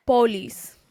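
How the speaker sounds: "Polis" with pure o and i; Spanish pronunciation. Shown as a demonstration. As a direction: Publix said Spanish-style as "Polis". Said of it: "how do you say it?".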